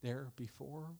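soft, sombre pulpit questions; quiet, pause-laden sermon delivery; hushed preaching. Real, not acted.

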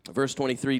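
ordinary talk

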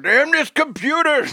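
put-on high, slow cranky old man shout; old man yelling. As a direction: yell at the computer like an angry old guy. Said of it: "a seventy-year-old guy going".

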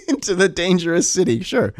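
mocking, laughing cop voice; sarcastic cop. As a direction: laugh through a sarcastic yes. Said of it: "No cop would be like".

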